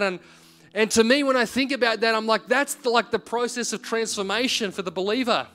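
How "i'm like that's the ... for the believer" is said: usual speaking voice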